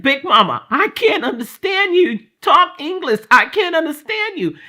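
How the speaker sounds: put-on a frightened teen calling to Big Mama; scared plea. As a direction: beg grandmother to speak English. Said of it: "I got scared".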